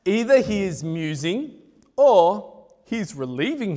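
mock Elijah teasingly in an Indian accent. Elijah taunting Baal's prophets in a laughing Indian accent. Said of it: "Sorry, my wife's Indian".

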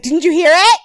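hammed-up offended insisting; mock indignant. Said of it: "She's like".

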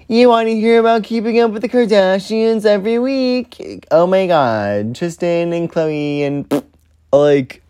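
high, bubbly valley girl mimicry of her old self; mocking valley girl. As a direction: send up her old podcast persona as a ditzy valley girl. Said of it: "I am gonna talk like a valley girl".

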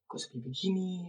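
puzzled mutter. Retold or imitated. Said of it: "kata gue".

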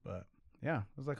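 normal talking voice

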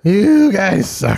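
put-on very slow, drawn-out drunk insult; slurring it out like a drunk caller; drunk dialing. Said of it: "drunk voicemails".

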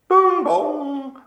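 high sung "Boom, boom" like a PA chime; chime imitation. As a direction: mimic the stadium tannoy chime. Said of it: "they play that every day at Hampden".

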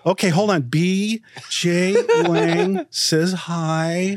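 mock dutiful message-taker, drawn way out; slowly noting it down. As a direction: draw out each word as if writing a message. Imitates clerk slowly noting a message.

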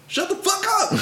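very high mock yell; high-pitched imitated shouting; retold yell. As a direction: squeal it out as an exasperated retort. Repeating his shouted retort.

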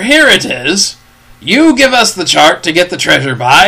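a pirate wheedling the captain for the chart; Long John Silver. Acted.

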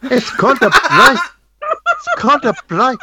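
laughing, hammed-up South African; bad South African accent, pleased with itself. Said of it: "my South African accent".

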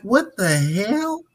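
slow, high "what the hell" of disbelief; inner disbelief. Retold or imitated. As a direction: think it in shock. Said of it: "in my mind".

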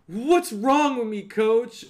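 put-on a touch of a teary boy begging; mock crying plea. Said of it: "Matt Saracen starts crying".

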